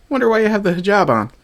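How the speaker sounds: high sarcastic put-on voice; sarcastic mock voice. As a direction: sneer at her in a falsetto.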